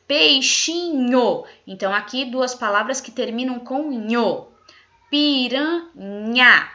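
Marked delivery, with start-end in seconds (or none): 0.1-1.4 slow, stretched word. Shown as a demonstration. Teacher to class, stressing the ending nho: slow stretched word. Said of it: "Palavras que terminam com, ó". 5.1-6.7 slow, stretched word. Shown as a demonstration. Teacher to class, stressing the ending nha: slow and louder. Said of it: "terminam com nha".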